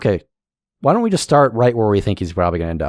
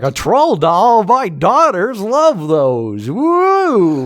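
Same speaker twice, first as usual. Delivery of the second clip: delighted dad; 9 semitones higher, 3 dB louder, much slower than usual. Retold or imitated. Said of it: "And the guy was like".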